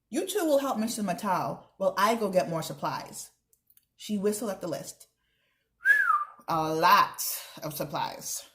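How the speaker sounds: lively read-aloud with character voices; teacher reading a chapter book, voices the characters; reading aloud to children. Acted. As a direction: read the story to the class with expression. Reads chapter aloud to class.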